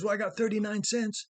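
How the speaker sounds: a hint of a hopeful kid counting coins; little boy voice. Boy tells farmer his money.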